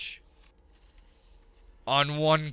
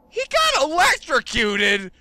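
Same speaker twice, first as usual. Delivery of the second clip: high, louder shocked repeat; shocked disbelief; 9 semitones higher, 4 dB louder than usual. Real, not acted.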